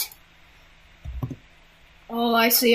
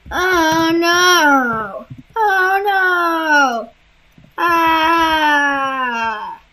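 hammed-up wail; 2 semitones higher, much slower than usual. Dramatic distress.

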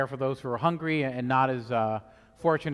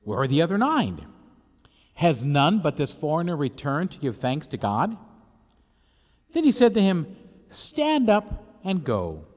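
solemn, measured liturgical reading; Gospel reading. Acted. Priest proclaims the Gospel.

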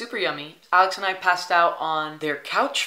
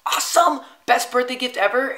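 high, loud burst of excitement; big, squealy-high delight; thrilled; 7 semitones higher, 7 dB louder than usual. Retold or imitated. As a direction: exclaim it like you just got the gift. Reacting to the surprise trip gift.

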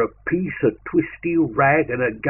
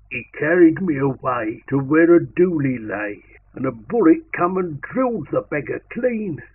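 plain reading voice

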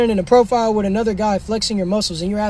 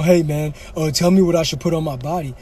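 mock viewer voice; 5 semitones lower than usual. Voicing a viewer's question.

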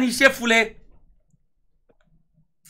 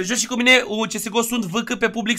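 ordinary talk

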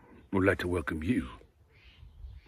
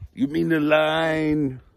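higher, louder, slow correcting yell; kids yelling; 5 semitones higher, 8 dB louder, slower than usual. Acted. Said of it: "we yell".

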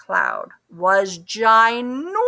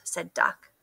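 ordinary delivery, nothing marked

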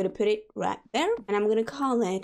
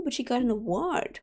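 ordinary talk

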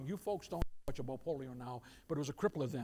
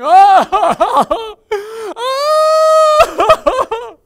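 very loud, high crying wail; long hammed-up howls of grief; 26 dB louder than usual. Shown as a demonstration. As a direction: demonstrate weeping uncontrollably at full volume. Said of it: "Crying, wailing".

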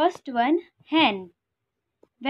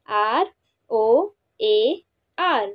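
usual speaking voice

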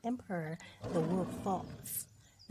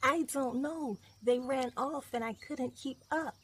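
high-pitched little cub voice; bear cub; 5 semitones higher, 3 dB louder than usual. Acted. Cub answers the wolf.

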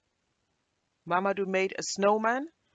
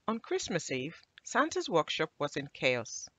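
ordinary delivery, nothing marked

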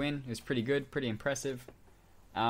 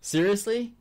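high, loud disbelieving Seriously; incredulous; 12 semitones higher, 9 dB louder than usual. Retold or imitated. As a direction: voice jumps up in disbelief. Friend's exasperated reaction retold.

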